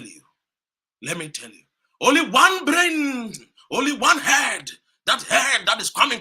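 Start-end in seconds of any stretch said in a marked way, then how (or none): 2.0-6.2 higher and louder, each word slow; slow emphatic shout. Real, not acted. Speaker praising Nkrumah to the crowd, slow emphatic shout. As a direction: pound out every word.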